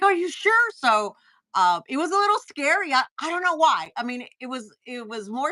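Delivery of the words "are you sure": a gasp, then a high, loud are you sure; shocked at the hotel prices; gasping disbelief. Retold or imitated. Reacting to the trip costs her husband shows, high shocked question.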